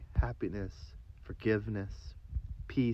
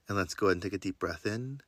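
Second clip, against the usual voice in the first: guided meditation; 2 semitones lower than usual. Acted. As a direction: guide them through breath and affirmations gently. Leads a breathing meditation.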